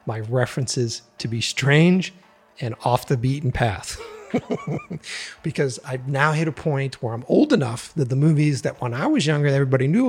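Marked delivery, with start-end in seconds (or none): 4.0-5.4 short laugh. Real, not acted. Laughing at own strange taste.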